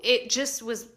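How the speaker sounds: usual speaking voice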